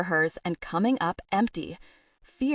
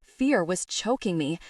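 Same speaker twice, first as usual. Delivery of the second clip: ordinary delivery, nothing marked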